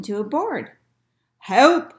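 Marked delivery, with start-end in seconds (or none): 1.4-1.9 loud, high storybook shout; desperate call from the water, acted; cry for help. A narrator's reading. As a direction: call out like a man overboard. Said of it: "he cried".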